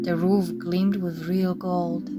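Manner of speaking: plain reading voice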